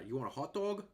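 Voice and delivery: a light New York twang on "dog" said "dawg"; New York accent. Retold or imitated. Retelling the snack bar guy, light New York accent. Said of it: "he called it dog, like he was from New York".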